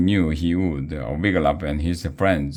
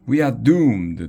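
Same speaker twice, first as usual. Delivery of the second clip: high, loud cry of doom; terrified yell; 6 semitones higher, 4 dB louder than usual. A narrator's reading. Toblet sees the ghost army, high frightened yell. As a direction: wail that the end has come. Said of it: "Toblet yelled".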